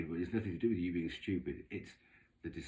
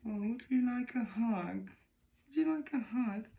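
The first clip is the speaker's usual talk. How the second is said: mock sweet offer. Neighbour sweetly offering a hug, high mock coo. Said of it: "is she going".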